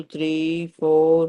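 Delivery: ordinary delivery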